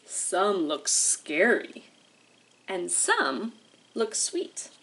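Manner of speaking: slow, lilting picture-book read with long pauses; reading to children. Acted. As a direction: read it like storytime for little kids. Teacher reads picture book aloud.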